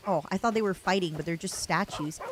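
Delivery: usual speaking voice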